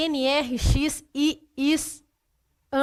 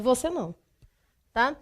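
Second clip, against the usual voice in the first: ordinary talk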